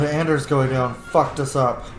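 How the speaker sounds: ordinary talk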